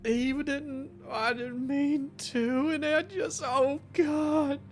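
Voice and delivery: pained, tearful patient blurting out his guilt; distraught injured patient. Acted. Billy confesses hitting his father to the doctor, tearful and pained. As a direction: plead through the pain, half crying.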